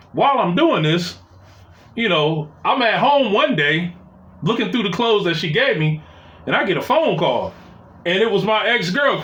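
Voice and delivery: ordinary delivery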